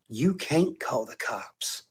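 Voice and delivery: slow, soft, knowing challenge; hushed, unhurried dare; quiet taunt. Real, not acted. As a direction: drop low in volume and spell it out slowly.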